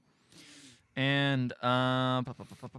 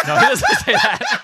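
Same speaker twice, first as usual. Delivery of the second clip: high, loud burst of laughter through the words; cracking up mid-sentence; laughing; 4 dB louder than usual. Real, not acted. Scene: correcting a fake quote while telling a friend, laughing.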